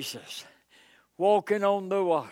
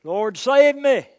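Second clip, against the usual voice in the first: cry for help; 4 semitones higher, 6 dB louder than usual. Acted. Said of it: "And he cried out".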